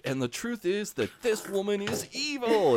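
high, hammed-up declaration of evil; fist-slamming declaration. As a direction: slam the fist and proclaim it. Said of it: "he slams his fist down and he's like".

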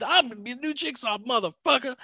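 high, fast swaggering Jackson impression; brash tough-guy boast, sped up; Samuel L. Jackson voice. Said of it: "and becoming".

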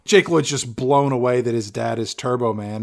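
normal talking voice

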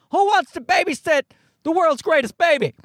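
high-pitched announcer-style pitch; mock call to action. Comedian voicing wife's babysitter ad, high proclaiming call. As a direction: pitch it high, like advertising for applicants. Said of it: "a little call to action".

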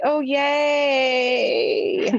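drawn-out mock cheer; fake, long-held enthusiasm; sarcastic. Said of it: "you're being sarcastic to Tyler".

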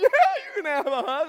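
high, loud delighted shout; hammed-up enthusiastic agreement; overjoyed yes. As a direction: burst out with eager welcome. Delighted yes to hug request.

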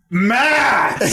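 high, held-out wrestling promo call; mock promo yell. Drunk friend calling out a rival, high mock promo yell. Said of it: "yelling, and he had, like, he had one inflection".

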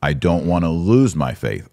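ordinary talk

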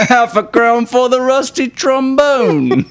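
mock Cockney laughing. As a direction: laugh while playing the London tart.

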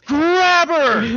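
high, loud mock battle cry; Ray rushing the ghost, shouting. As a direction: shout the order to charge. Ray's plan shouted.

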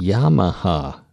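Yamaha slowly as "YAH-muh-hah"; slow pronunciation. Shown as a demonstration. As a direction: copy the guide, drawing out Yamaha. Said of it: "I'll say it slowly".

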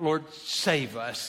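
soft, slow pleading prayer; hushed plea. Retold or imitated. The crowds crying out to Jesus, hushed and slow. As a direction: breathe it out as a quiet plea. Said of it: "they cried out".

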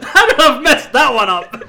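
cracking up at his own blunder; laughing. Real, not acted. Laughing at own wrong reference.

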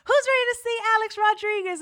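high, loud, fast hype call; event host. Retold or imitated. Host asking audience about the keynote speaker, hyped. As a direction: imitate an emcee firing up the crowd. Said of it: "And they're like".

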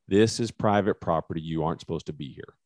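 usual speaking voice

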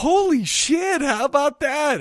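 high, slow amazed exclamation; mock amazed. Retelling his own reaction to a t-shirt, amazed and high. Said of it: "I was like".

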